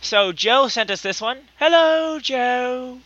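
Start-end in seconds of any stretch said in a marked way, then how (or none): 1.6-3.0 a lightly put-on English accent on hello Joe; poor English accent. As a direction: try a British greeting and miss. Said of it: "a poor attempt at an English accent".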